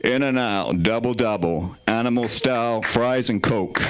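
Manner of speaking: low American announcer-style accent. Shown as a demonstration. Comedian reading a burger menu to the crowd, in an American accent. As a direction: drop the pitch into a put-on American voice. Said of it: "Can I do American menu with an American voice?".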